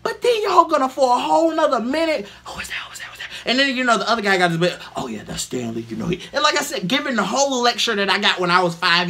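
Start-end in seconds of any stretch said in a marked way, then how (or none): 2.5-3.3 soft, hushed repeated question; hushed whispering. Retold or imitated. Crowd whispering again. 5.0-6.2 knowing explainer. Retold or imitated. Other guy explains.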